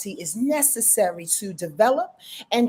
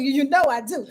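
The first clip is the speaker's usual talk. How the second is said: usual speaking voice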